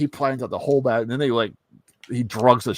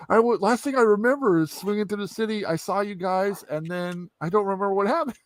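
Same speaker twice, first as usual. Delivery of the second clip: high-pitched, puzzled recounting; bewildered Peter Parker; 10 semitones higher than usual. Acted. Peter Parker telling the guys his last memory, bewildered. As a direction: piece it together in confusion.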